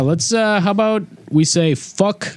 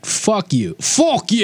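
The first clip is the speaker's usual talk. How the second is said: mock English; 8 semitones higher, slower than usual. Mock British swearing bit.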